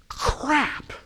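put-on high-pitched, exasperated childish cry; mock whiny outburst. Client caught out by his counselor, mock whiny outburst.

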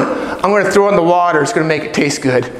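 low, quick, offhand answer; matter-of-fact Moses. Acted. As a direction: toss it off as perfectly obvious. Moses deadpans his odd plan.

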